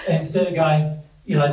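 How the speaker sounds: ordinary talk, nothing marked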